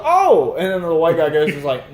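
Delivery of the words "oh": high, loud "Oh!"; surprised gasp. Retold or imitated. Quoting the rapper hearing the offer, shocked. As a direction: jolt of surprise.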